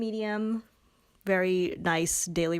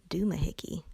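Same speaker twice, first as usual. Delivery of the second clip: whispered doomahickey, unvoiced; whispered word; 2 semitones lower, 3 dB quieter than usual. Real, not acted. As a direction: breathe the word doomahickey as a secret.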